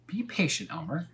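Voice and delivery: high, gentle cat voice; patient cat. Acted. Cat calming impatient Elmer, high gentle voice. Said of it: "said the cat".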